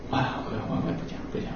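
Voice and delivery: barely audible whisper, trailing off; whispered aside. Real, not acted. Teacher giving up on listeners, whispered aside. Gives up explaining, to himself.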